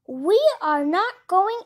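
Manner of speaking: loud, high, angry shout; furious, loud refusal. Acted. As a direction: bellow it at the stowaway duck. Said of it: "he shouted".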